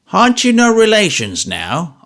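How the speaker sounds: put-on sly, twinkling old man. A narrator's reading. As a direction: tease slyly. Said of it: "with a twinkle in his eyes".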